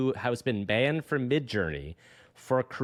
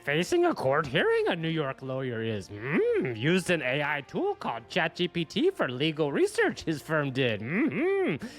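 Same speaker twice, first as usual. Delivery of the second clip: croaky sage, pitch swooping into long hums; Yoda; slower than usual. Acted. Host reading the lawyer headline in Yoda voice. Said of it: "get my Yoda voice on".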